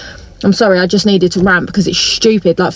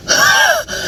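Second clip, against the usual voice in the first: exasperated sigh. Real, not acted. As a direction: let out a big weary groan of a sigh. Exasperated rant about parking costs.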